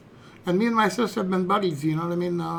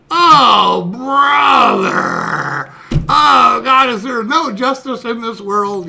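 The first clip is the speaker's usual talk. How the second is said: loud, higher, hammed-up despair; exasperated outcry; 4 semitones higher, 10 dB louder, much slower than usual. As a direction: bellow the injustice, drawing out the opening words. Boy outraged at sister's light punishment.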